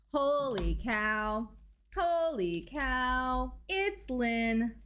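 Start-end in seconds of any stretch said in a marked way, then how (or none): none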